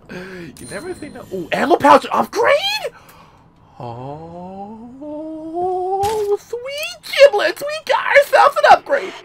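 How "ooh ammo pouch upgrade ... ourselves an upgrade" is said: very high, slow falsetto shout; shrieking mock delight; hammed-up squeal. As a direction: squeal like a giddy cartoon character. Hammed-up upgrade celebration.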